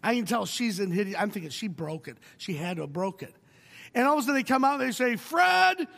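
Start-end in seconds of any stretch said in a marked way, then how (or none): 5.2-5.9 loud high call across a waiting room; mock nurse calling the next patient; calling out a name. Staff calling names in ER.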